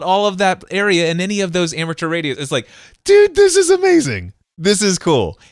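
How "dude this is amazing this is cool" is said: high, loud burst of wonder; excited amazement. Retold or imitated. Radio newcomer marvelling at his far-carried voice, high excited exclamation. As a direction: blurt it out, thrilled. Acting out his thrilled reaction.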